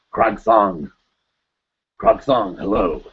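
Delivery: lightly voiced film warrior; a hint of barbarian hero; Conan warrior voice. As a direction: say the name like a Schwarzenegger warrior. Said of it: "say your name like you're some, um, Conan warrior".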